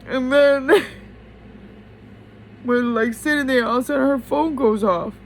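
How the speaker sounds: high, loud, breathless with a laugh; rushing on, voice lifting high; excited. Real, not acted. Emotional retelling of the dream.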